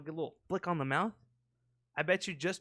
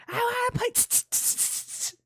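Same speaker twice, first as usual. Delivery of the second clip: put-on whiny kid voice; 4 dB louder than usual. Mocking a kid who wants to play, whiny and high, then tss hisses. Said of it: "It's like".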